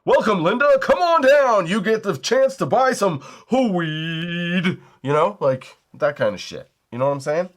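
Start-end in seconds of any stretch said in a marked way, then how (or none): 0.0-4.8 high, loud contestant call-down; game-show announcer. Acted. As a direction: belt out a hammed-up game-show welcome, stretching the last words. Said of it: "call them down like".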